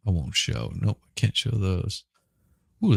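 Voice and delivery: normal talking voice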